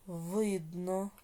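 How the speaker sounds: slow, drawn-out syllables with rising ends; dictation. Shown as a demonstration. Teacher dictating exercise eight to pupils, slow measured dictation. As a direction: dictate each word slowly, leaving long writing pauses.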